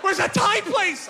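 very high, frantic shouts of a hungry crowd; panicked shouting. Retold or imitated. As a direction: yell it like a lost drunk mob. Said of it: "Everyone's like".

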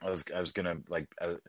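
ordinary talk, nothing marked

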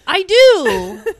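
loud, high, delighted recognition; imitated singer's reply. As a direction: play an idol exclaiming in recognition.

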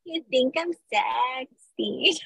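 slow, drawn-out exaggerated sultry call; mock sexy line. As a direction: ham up the seduction. Mocking Gracie's flirting.

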